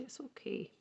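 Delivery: ordinary delivery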